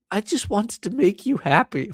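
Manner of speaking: high, breath-filled mock whimper; imitated sheepish girlfriend. Girl excusing melted ice cream, mock sheepish whimper. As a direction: plead it in a small high whisper.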